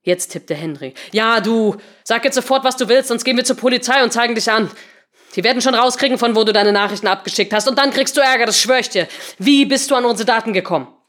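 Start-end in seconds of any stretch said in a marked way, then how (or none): 1.1-10.9 loud, raised-pitch tirade; heated demand for answers; angry threat. A narrator's reading. Henry angrily threatens the supposed hacker.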